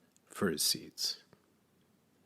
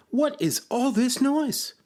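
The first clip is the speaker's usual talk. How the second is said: high, loud, drawn-out question; startled demand; 5 dB louder than usual. A narrator's reading. Frog runs up to Toad's din, high loud exclamation. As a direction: exclaim it high, stretching the words.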